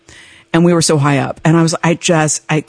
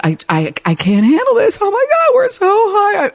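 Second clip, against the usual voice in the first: panicked crying. Retold or imitated. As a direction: cry out in terror, voice cracking high. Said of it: "I just kept crying".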